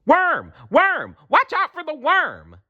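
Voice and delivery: put-on squealing kids yelling worm; children yelling. A narrator's reading. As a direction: shout it like a panicked class. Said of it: "All the children started yelling".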